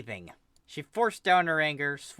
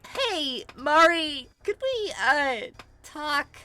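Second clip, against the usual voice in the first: much higher, squeaky nervous ask; 14 semitones higher, 6 dB louder, much slower than usual. Acted. Kel addressing furious Mari, nervous squeak. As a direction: force a nervous friendly opener.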